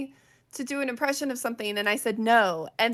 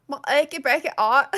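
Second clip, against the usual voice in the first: louder put-on Yorkshire accent; 3 semitones higher, 6 dB louder than usual. Friend echoing the achy breaky heart thing, Yorkshire accent. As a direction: belt out a mock Yorkshire accent. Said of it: "I always call it a Yorkshire accent".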